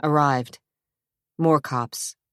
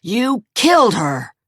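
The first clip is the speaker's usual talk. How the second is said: loud, high accusation; accusing yell; 7 semitones higher, 6 dB louder than usual. A narrator's reading. Zach to Jason, loud accusing yell. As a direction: throw the blame at him.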